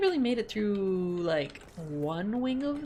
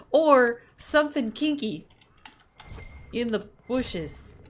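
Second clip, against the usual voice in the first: suggestive joke; 3 semitones higher, 8 dB louder, slower than usual. Real, not acted. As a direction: drag out the naughty hint. Suggestive joke about paddles.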